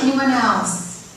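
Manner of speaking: usual speaking voice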